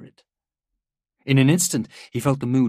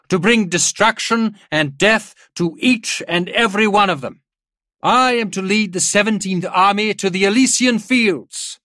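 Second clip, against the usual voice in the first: loud, high-pitched war oratory; proclamation read aloud; 10 semitones higher, 8 dB louder than usual. A narrator's reading. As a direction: thunder out the written orders to the army. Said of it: "began to read".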